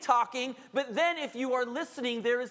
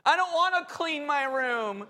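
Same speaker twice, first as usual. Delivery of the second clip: put-on rising, louder childish whine; whining kid; 5 semitones higher, 3 dB louder than usual. Kid refusing to clean room, whining louder each line. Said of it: "How many of you have heard that before".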